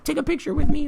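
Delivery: very high, bubbly fan voice; mock eager fan. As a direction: play a gushing fan asking for a selfie. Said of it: "or going".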